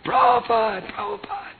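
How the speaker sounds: high urgent call, dropping off at the end; running from the temple, calling to the guru at his car. Retold or imitated. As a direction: shout his name as you run out.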